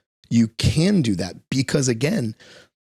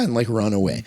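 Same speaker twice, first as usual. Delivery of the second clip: ordinary delivery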